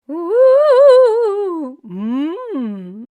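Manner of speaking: loud, high ooh then a savoring mm; loud, high ghostly ooh of delight. Acted. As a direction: taste the pie happily as the ghost. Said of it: "said the ghost".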